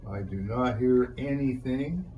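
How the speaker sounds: ordinary talk, nothing marked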